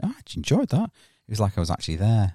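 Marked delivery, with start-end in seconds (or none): 0.0-0.9 high-pitched retold surprise; pleasantly surprised. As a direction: recall your pleased surprise in a higher voice. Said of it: "I went".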